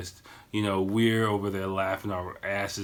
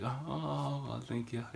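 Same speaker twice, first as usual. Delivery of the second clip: soft, higher-pitched gracious deflection; polite brush-off; 4 semitones higher, 8 dB quieter, much slower than usual. Shown as a demonstration. Showing his move to escape people, polite brush-off. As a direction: demonstrate a gentle dodge disguised as thanks. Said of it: "it's kinda my move".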